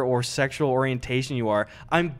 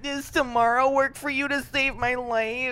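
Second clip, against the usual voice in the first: higher put-on mocking voice; mock clueless ask; 8 semitones higher than usual. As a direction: sneer at his request. Mocking the owner's request.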